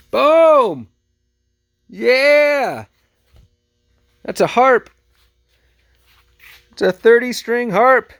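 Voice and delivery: loud, high-pitched triumphant announcement; excited reveal. Real, not acted. Vlogger unveils the thrift store harp to viewers, loud and excited. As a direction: show it off with big, high, punched excitement.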